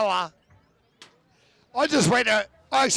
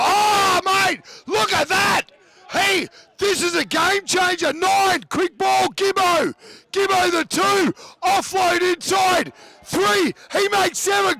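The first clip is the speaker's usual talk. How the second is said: excited, higher rugby call; live play-by-play; 5 semitones higher than usual. Acted. Commentator calling the Tigers' attack for listeners, excited play-by-play. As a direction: ride the action as it unfolds.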